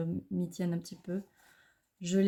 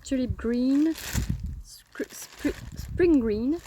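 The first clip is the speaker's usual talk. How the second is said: names read with a light English accent, a touch louder and higher; 5 semitones higher, 6 dB louder, much slower than usual. Shown as a demonstration. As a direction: show off your English accent on the plant names. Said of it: "ouais, pour mon accent anglais". (avec l'accent anglais, un peu appuyé)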